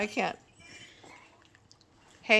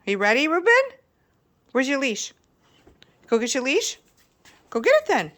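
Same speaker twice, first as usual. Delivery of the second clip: higher, louder eager coaxing; excited dog talk; 6 semitones higher, 6 dB louder than usual. Real, not acted. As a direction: pump the dog up for the walk. Excitedly urging dog to get leash.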